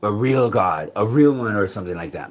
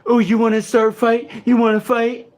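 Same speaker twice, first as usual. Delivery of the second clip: much higher, louder mocking challenge; taunting voice; 11 semitones higher, 4 dB louder than usual. Mimicking the taunting voice daring him to fight, high and mocking. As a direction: sneer it like a bully daring a fight. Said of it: "How is it that I wanna".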